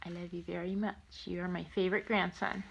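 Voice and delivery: soft, loving goodbye; tender. Real, not acted. Grandma saying goodbye to her grandson, soft and tender.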